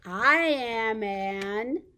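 loud, drawn-out reveal; reading to children. Pretend. Revealing the riddle's animal to toddlers, big slow reveal. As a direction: stretch the answer out proudly for little ones.

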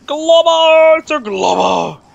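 high, loud, stretched-out cry of delight; excited shout. Real, not acted. Gamer spotting Glommer, excited high shout. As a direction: shriek the name as you spot it.

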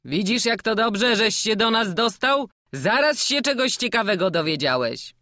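put-on a jovial old jester coaxing the boy; hearty old showman. (dziarski stary kuglarz, głośniej)

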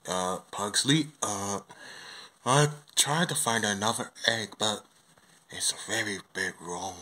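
low, soft bear voice, hesitant; weary Paddington. Acted. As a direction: answer haltingly, low and hushed, like a worn-out bear. Tired bear answers the king.

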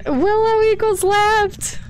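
high, slow sing-song reminder. Pretend. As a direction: chant it up high like a mantra. Mnemonic said to self, high.

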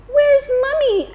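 put-on high worried baby owl. Child owl Sarah asks for mother.

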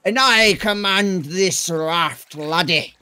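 slow, hammed-up pirate captain. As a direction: drawl it like a raft pirate. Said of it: "my pirate voice".

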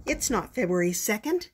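usual speaking voice

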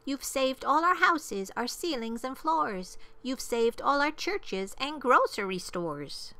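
high-pitched, earnest little mayor; small high voice full of gratitude; grateful tiny mayor. A narrator's reading. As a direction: pipe out heartfelt thanks in a high voice. Mayor of tiny folk thanks Horton.

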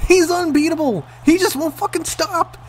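high-pitched, frustrated outcry; exasperated yelling. Real, not acted. Player raging at JBL who will not stay down, exasperated yelling. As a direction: rant helplessly at an unstoppable opponent.

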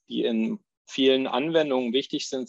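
ordinary delivery

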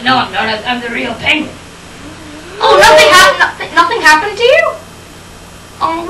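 ordinary delivery

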